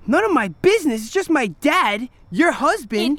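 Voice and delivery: high, loud, bitter repeat; furious retort. Acted. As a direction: hurl her words back at her. Throws mother's words back angrily.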